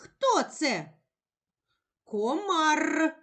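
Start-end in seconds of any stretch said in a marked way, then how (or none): 2.0-3.2 "Комар" with a long rolled р at the end; emphasised r. Shown as a demonstration. Teacher helping a child locate the r, emphasised. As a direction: hold the final trill of "Комар", pitch raised. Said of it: "Де ми чуємо звук р".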